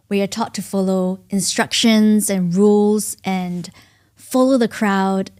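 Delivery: normal talking voice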